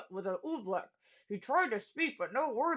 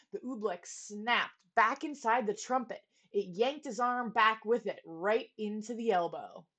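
usual speaking voice

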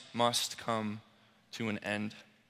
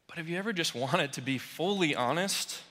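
ordinary talk, nothing marked

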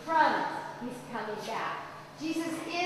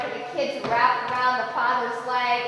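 ordinary delivery

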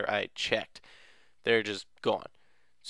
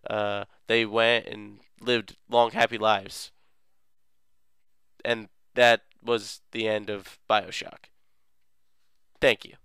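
ordinary delivery

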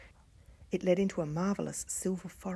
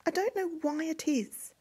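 put-on lightly raised, higher young girl's voice; a fretful young princess, voiced higher; youngest princess voice; 10 semitones higher than usual. A narrator's reading. Youngest princess confides her worry to sisters, higher girlish voice. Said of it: "she said".

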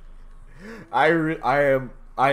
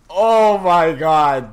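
usual speaking voice

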